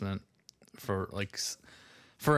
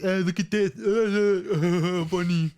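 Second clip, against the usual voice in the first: raised, loud mock kid clowning; goofy kid antics; 9 semitones higher, 5 dB louder, slower than usual. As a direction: act out a rowdy kid showing off. Boys goofing around humping chairs.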